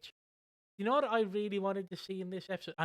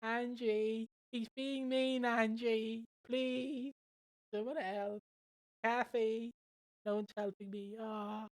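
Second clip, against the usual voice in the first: whiny Lofty plea; 4 semitones higher, 3 dB quieter, slower than usual. Retold or imitated. Mock Lofty begging for help.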